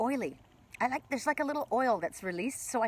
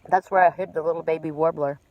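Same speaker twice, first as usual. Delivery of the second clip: mouth full; 2 semitones lower, 4 dB louder than usual. Real, not acted. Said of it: "My mouth full".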